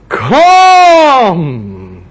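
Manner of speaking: loud drawn-out call across the water. Acted. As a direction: call him over the waves. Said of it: "The voice came rolling and says".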